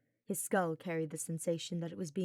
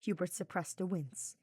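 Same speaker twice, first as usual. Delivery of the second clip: usual speaking voice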